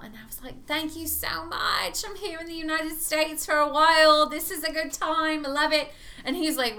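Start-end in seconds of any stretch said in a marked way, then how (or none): 0.7-5.9 higher-pitched fake English accent; put-on English accent. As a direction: lay on a hammed-up English accent. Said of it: "the stronger the accent came".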